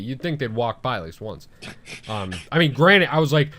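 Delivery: normal talking voice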